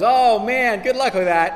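high, loud teasing laugh-line; amused stranger. Retold or imitated. Man with umbrella teasing a soaked preacher, loud and amused. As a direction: toss it off like an amused stranger at a bus stop. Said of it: "and he goes".

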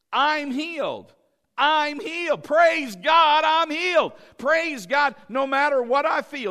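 from plain to loud high shouting; preacher working up to a full shout; rising shout of faith. Acted. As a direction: build from a claim into a triumphant shout.

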